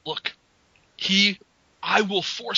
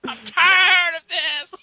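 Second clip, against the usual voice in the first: slow, loud high whine; whining; 8 semitones higher, 9 dB louder, slower than usual. Pretend. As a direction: drag it out like a sulking kid. Acted whiny scene.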